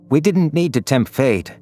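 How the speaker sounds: ordinary delivery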